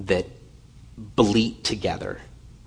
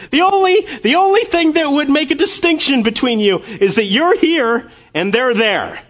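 the Lord pleading; 10 dB louder than usual. Acted. The Lord asks his people to remember being lost, high and loud pleading. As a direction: plead like a shepherd calling his flock back. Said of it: "A-, and he's saying".

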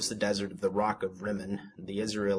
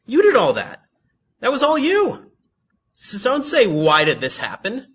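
loud, high acted scolding; God's indignant rebuke; 8 semitones higher, 9 dB louder than usual. God answering Benjamin, indignant mock rebuke. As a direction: build to high, loud blame on each accusation. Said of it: "he would say".

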